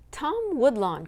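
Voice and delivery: high, loud scolding call of his name; sharp cry. Acted. Mother scolding her son, sharp loud cry. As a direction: call him up short. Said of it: "cried his mother".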